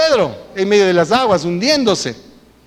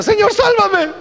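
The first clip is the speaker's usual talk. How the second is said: high, loud cry for rescue; shouted plea; 13 semitones higher, 3 dB louder, slower than usual. Acted. Peter sinking in the water, crying out loud and high. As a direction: cry out to be saved.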